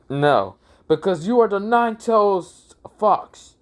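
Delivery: high, acted accusation; accusing outburst. Mizuki tells Naruto why the village hates him, accusing outburst. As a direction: raise the pitch in a hammed-up denunciation.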